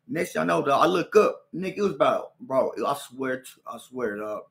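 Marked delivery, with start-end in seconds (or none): none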